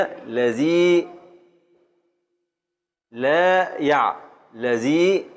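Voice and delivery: very slow, each long vowel held; drawn-out teacher's demonstration of the foot; syllable-by-syllable scansion. Shown as a demonstration. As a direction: stretch out "الذي لا يع" piece by piece. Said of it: "قطع لنا البيت".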